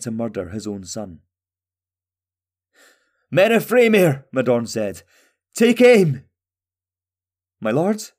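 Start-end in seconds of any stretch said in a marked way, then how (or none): 3.3-6.2 high, loud order to the archers; king ordering his men to take aim; battle command. A narrator's reading. 7.6-8.1 higher, rising, worried query; concerned question. A narrator's reading. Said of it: "asked with concern in his voice".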